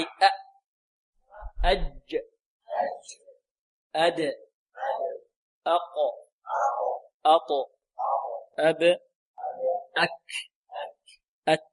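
isolated syllables أج، أد، أق، أط، أب، أك، أت, each cut off by a stop; plosive letter drill. Shown as a demonstration. Teacher has students repeat, stopped-sound drill أج، أد، أق، أط، أب، أك، أت. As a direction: stop the sound on each consonant, pausing between. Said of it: "انطقوا معي".

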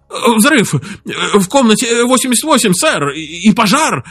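breathless, high panicked report; gasping for air. Acted. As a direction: gasp it out between words. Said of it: "задыхаясь, проговорил сержант".

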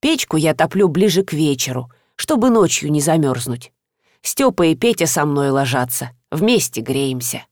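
lightly voiced elderly teacher; old teacher, resigned. Old teacher explains her life.

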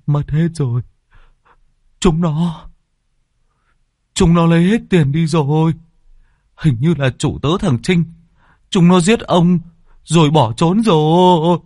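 high, loud frantic gasps of panic; panicked. A narrator's reading. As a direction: blurt it out in a flustered panic. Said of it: "Nó luống cuống". (hoảng loạn, giọng cao và to)

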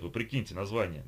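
ordinary delivery, nothing marked

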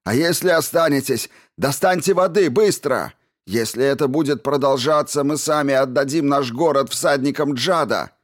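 high, urgent, desperate shouting; strained shout. A narrator's reading. Man pleads with the mob to fetch water, shouting. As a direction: keep shouting, voice pushed up and strained. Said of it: "крикнул Хусари сорванным голосом".

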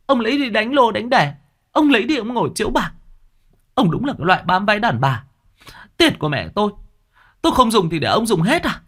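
shrill-free: gào to, giọng vọt cao hẳn; cô gái gào thét tố cáo người cha dượng; gào lên. A narrator's reading. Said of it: "nó nói như gào lên". (gào lên uất ức)

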